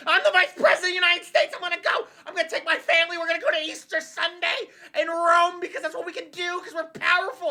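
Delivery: high, fast boastful mock voice; squeaky self-important brag; entitled J D Vance. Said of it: "J D Vance is like".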